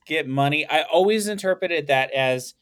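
usual speaking voice

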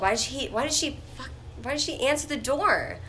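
a mock-outraged rant about the door; exasperated outburst. Telling the story, replaying her outrage at the owner. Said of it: "I'm like".

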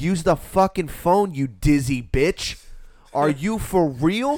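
loud, slow disbelief; exasperated scolding. Retold or imitated. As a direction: stretch the insult out, fed up. Acts out scolding the girlfriend.

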